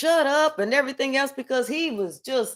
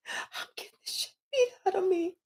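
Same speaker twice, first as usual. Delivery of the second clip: crying lament; 7 semitones higher, 9 dB quieter, faster than usual. Acted. Remorseful King lamenting.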